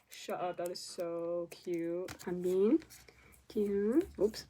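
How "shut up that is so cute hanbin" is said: ordinary talk, nothing marked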